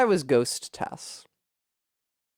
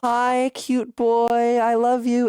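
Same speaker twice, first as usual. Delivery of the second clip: put-on swooning boy-crazy; 7 semitones higher than usual. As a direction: melt over the delicious smell. Boy smell redeem, swooning.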